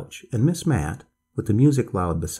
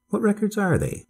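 higher-pitched, prompt question; quick question; 11 semitones higher than usual. A narrator's reading. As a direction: jump in with a high-pitched question. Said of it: "Miss Matt asked quickly".

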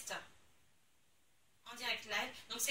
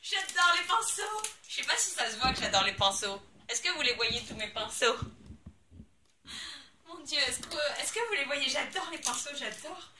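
gushing joy; 2 semitones higher, 7 dB louder than usual. Real, not acted. Unboxing, gushing over brushes. (joie débordante, forte et aiguë)

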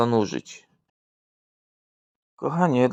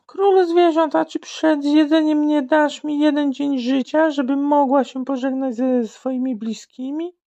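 much higher, pleading zebra voice; squeaky begging zebra; 13 semitones higher than usual. Acted. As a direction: beg the lion in a high animal voice.

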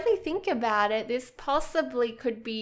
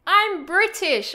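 higher, louder, slow mock-proud declaration; proud dad; 7 semitones higher, 6 dB louder, slower than usual. Quoting her dad's claim to her, mock proud declaration. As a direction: puff up like a proud dad announcing it. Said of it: "and he was like".

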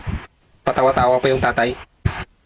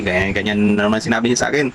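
ordinary talk

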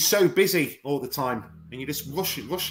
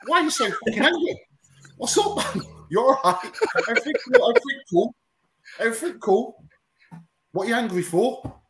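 much higher-pitched mock confrontation; retold incredulous questioning; 8 semitones higher, faster than usual. Retelling a call to the coach's old student, mock incredulous. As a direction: imitate a baffled phone challenge, pitched right up. Acts out phoning the angry student.

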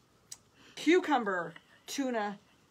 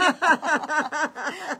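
loud, higher burst of laughter; amused laugh; 4 semitones higher, 7 dB louder than usual. Real, not acted. Amused by reading a viewer's comment.

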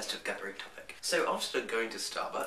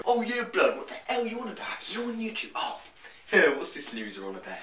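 mocking strangers; 10 semitones higher, 5 dB louder than usual. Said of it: "Then they go".

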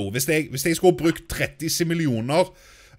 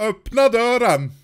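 louder and higher, calling out; 7 semitones higher, 7 dB louder than usual. Real, not acted. Gamer shouting at the neighbours to open the door.

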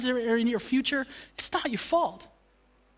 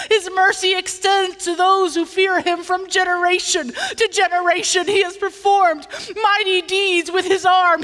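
louder, higher reading with conviction; fervent scripture reading; 8 semitones higher, 6 dB louder than usual. Acted. Pastor reading Mary's song to church, fervent. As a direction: proclaim the psalm.

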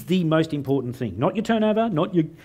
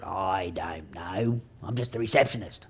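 low, slow, softer bored receptionist; a sulky lemon-sucking receptionist, much slower; sour receptionist; 7 semitones lower, 8 dB quieter, slower than usual. Retold or imitated. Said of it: "She goes".

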